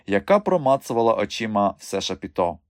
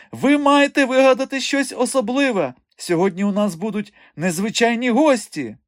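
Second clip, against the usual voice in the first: high, loud, fussing demand; flustered urging; 12 semitones higher, 4 dB louder than usual. A narrator's reading. Directress expecting unusual guests, flustered urging. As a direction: press for something special at a high, excited pitch. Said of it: "метушилася директриса".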